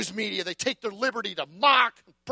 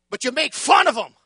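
ordinary talk

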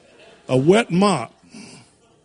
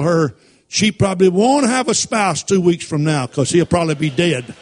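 loud scolding mother, finger-wagging; retold furious mother telling off her son; 3 semitones higher, 5 dB louder than usual. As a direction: scold him like an angry mom shaking a mop. Mother scolding him with mop.

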